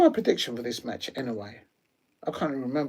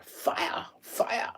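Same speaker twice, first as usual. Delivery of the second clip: fading whisper; 8 semitones higher, 6 dB quieter than usual. Acted. Trailing repetition.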